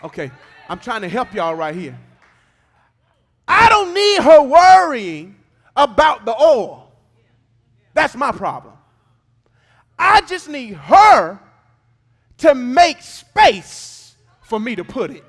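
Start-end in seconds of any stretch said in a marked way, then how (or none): 3.5-15.2 loud, slow and high, each phrase hammered out; thundering preaching. Acted. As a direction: boom God's words out slowly over the church. Voicing God's words at a climax.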